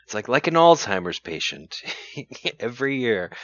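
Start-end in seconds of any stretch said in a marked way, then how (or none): none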